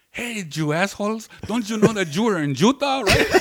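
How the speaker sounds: put-on high-pitched scolding in a thick foreign accent; Ludo's thick accent. Woman scolding giggling friends from her door, thick accent. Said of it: "has this really thick accent".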